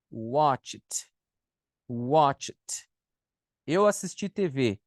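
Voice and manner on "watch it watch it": "watched" as "watch-t", one syllable; CH plus T ending. Shown as a demonstration. Teacher drilling an important ending, "watched" as "watch-t". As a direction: release a separate T straight after the CH in "watched". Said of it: "Com C H".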